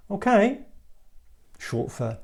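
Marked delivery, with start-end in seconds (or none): 0.1-0.6 "Okay" with a high rise; questioning inflection. Shown as a demonstration. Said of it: "that inflection can turn any word or sentence into a question".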